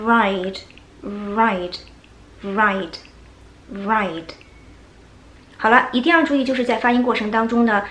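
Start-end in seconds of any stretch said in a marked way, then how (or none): 0.0-4.3 slow repeated ride for practice; pronunciation drill. Shown as a demonstration. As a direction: ride drawn out very slowly, tongue tip curled up. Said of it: "第三个".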